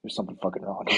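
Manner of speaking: hiss-whisper a warning; a captain quietly warning his agents about the body; urgent whisper. Retold or imitated. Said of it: "It's kinda like".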